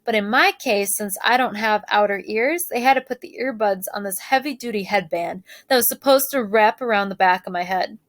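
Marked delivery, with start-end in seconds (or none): none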